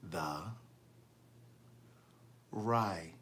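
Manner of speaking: low, soft "the writer", pulled apart; very slow. Shown as a demonstration. Host giving Stephanie her Cash App name, very slowly. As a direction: draw out each syllable quietly and deeply. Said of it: "I'm gonna talk very slowly".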